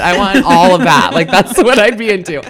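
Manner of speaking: loud, emphatic enthusiasm through laughter; excited gushing. Real, not acted. Guest telling hosts her fantasy butcher, excited gushing. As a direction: demand it loudly, delighted by the joke.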